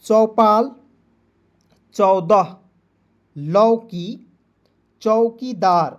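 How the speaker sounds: loud word-by-word classroom reading; read-along drill. Shown as a demonstration. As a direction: call out each word, then leave room to repeat. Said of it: "मेरे साथ आप सभी लोग पढ़ेंगे".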